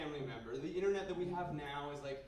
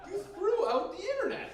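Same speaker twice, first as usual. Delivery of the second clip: very high, loud disbelieving cry; incredulous outrage; 6 dB louder than usual. Acted. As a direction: squeal it in comic disbelief. Mock outrage at throwing it out.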